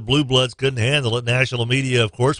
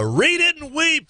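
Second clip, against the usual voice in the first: gloating; 13 semitones higher, slower than usual. Retold or imitated. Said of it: "first base says".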